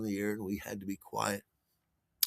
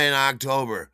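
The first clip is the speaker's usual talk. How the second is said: ordinary talk, nothing marked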